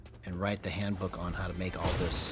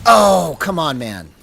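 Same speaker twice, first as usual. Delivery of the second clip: frustrated outburst; 7 semitones higher, 15 dB louder, slower than usual. Real, not acted. Dies in game, frustrated.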